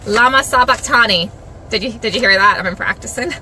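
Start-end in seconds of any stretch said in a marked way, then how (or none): 0.0-1.3 loud, declaimed cry from the cross. Acted. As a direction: proclaim the scripture cry loudly. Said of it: "Jesus cried out in a loud voice".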